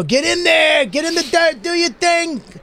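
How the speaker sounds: high, urgent sideline yelling; cheering sports parent. Retold or imitated. Other parents shouting to their kids at a game, high holler. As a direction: yell instructions from the bleachers. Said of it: "other parents would be like".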